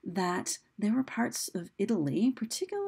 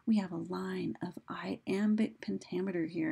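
ordinary talk, nothing marked

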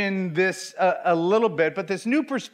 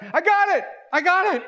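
excited; 10 semitones higher than usual. Retold or imitated. Dad at the mall finally seeing the magic eye, excited cry. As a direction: shout it like you finally cracked it. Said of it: "I was super excited".

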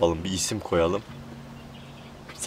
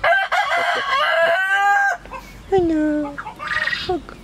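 squeaky bird voice; much slower than usual. Pretend.

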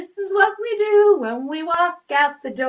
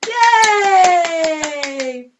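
cheering; 4 semitones higher, 8 dB louder than usual. Real, not acted. Cheering after rhyme.

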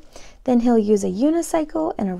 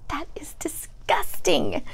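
unvoiced whisper of disgust; 4 semitones higher, slower than usual. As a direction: whisper it like it tastes awful.

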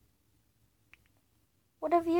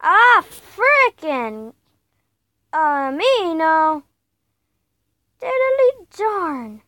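slow mock frustration; 8 dB louder, much slower than usual. Real, not acted. Frustrated at game.

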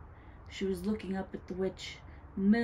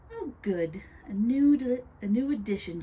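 mildly gloating witch; evil delight; 4 semitones higher, 7 dB louder than usual. A narrator's reading. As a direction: relish it with a wicked smile. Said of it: "she smiled evilly".

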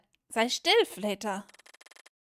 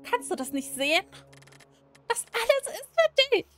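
higher, louder, insistent voice of a clingy captor; possessive game character; 8 semitones higher, 5 dB louder than usual. Acted. Peter pressing Miss not to leave, high insistent voice. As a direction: plead and demand at once, pitched up.

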